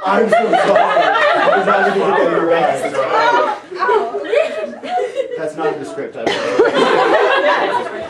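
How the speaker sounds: high, slow apology through laughter; real laughing apology. Real, not acted. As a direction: apologize while cracking up.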